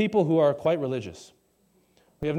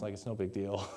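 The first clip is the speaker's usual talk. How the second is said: ordinary talk, nothing marked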